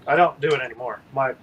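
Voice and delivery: ordinary delivery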